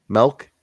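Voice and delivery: "milk" loudly as MELK. Retold or imitated. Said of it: "You hear those people?".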